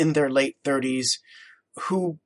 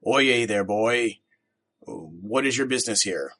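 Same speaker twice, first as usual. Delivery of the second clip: a lightly voiced older businessman, a bit lower; gruff greeting to a stranger boy; the partner with the deeper voice questions the boy; 3 semitones lower than usual. A narrator's reading. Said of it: "His voice low and deep".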